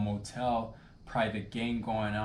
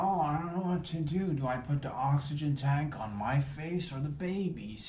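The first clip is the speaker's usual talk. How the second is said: flabbergasted; 4 semitones higher than usual. Retold or imitated.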